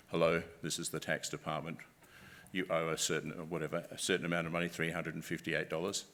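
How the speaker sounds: low, even voice of mock authority; deep-voiced scam caller. Tax department scammer leaving an answering machine message, deep voice. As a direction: play an official caller, pitched well down. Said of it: "they've got a very deep voice".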